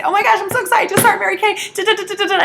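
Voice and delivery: high, loud, fast gush; overexcited gushing. Retold or imitated. Excited new consultant gushing at her friend about Mary Kay. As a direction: rattle it off, bursting with excitement. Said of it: "if I were so excited".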